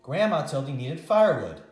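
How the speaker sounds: ordinary delivery, nothing marked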